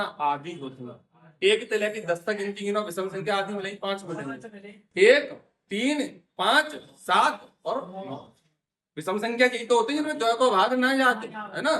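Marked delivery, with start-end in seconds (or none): 5.1-8.2 louder, slow counting with a beat on each number; slow emphatic counting. Shown as a demonstration. Teacher counts the odd numbers out for pupils, slow and loud.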